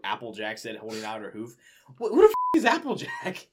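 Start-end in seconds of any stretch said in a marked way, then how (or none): 2.0-3.5 real incredulous shout, much higher; baffled outburst. Real, not acted. Reader shouts at the story in real disbelief. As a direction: yell it in disbelief.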